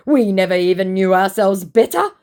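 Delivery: high, loud, theatrical lamenting; hammed-up tragic suitor; mock lament. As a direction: mimic him bemoaning his fate. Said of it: "he laments".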